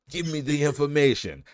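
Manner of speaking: lightly hammed-up gangster shout; mock yelled demand. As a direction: push it like a fed-up interrogator. Third demand, raised.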